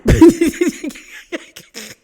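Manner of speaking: a burst of loud, high laughter; sudden loud high-pitched laugh. Real, not acted. Laughs about Vicki crying.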